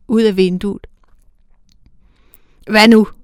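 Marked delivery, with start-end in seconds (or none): 2.7-3.1 a sharp, much louder demand. A narrator's reading. Elder angel sharply questioning the young one, sharp. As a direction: bark the question at her. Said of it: "spurgte den ældre skarpt".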